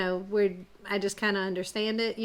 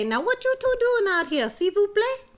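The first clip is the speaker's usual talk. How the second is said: much higher, louder Cajun gator; 12 semitones higher, 4 dB louder than usual. Acted. Gator Claude greeting the children in a high Cajun voice. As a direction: squeal it out like a sly old gator in a Cajun accent.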